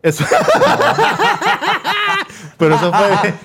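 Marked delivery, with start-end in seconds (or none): 0.3-2.5 high burst of laughter. Real, not acted. Laughing after being caught naming his uncle. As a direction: cracks up high.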